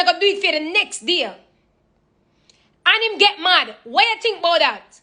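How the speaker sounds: high Jamaican patois mom laying out a story; a Jamaican mom testing her daughter. Retold or imitated. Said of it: "My mom will ask me".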